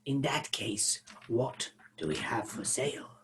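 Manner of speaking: low, breathy dragon whisper. Acted. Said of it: "You went back into Dragon Voice then".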